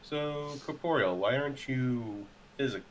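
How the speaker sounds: ordinary talk, nothing marked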